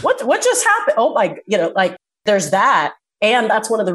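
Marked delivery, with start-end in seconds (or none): 0.0-1.0 sudden baffled what, pitched way up; startled, as if you lost ten minutes; confused surprise. Retold or imitated. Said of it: "You're like".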